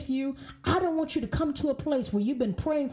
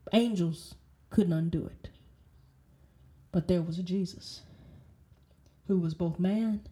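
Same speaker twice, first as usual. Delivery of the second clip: solemn preaching; 6 semitones lower than usual. Real, not acted. Preacher telling listeners only Jesus could open the scroll, solemn. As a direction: let each phrase land low and measured.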